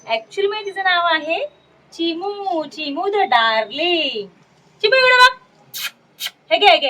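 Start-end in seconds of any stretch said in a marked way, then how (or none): 4.8-6.9 high, loud call to the cat. Real, not acted. Owner calls Chimu to look this way, high and loud. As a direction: sing out to get the cat's attention.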